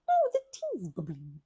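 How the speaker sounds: usual speaking voice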